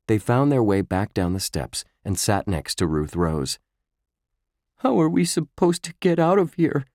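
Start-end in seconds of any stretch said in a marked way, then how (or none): 4.8-6.8 high, trembling worried question; shaky voice. A narrator's reading. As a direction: ask with a shaky voice. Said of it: "Josh asked in a shaky voice".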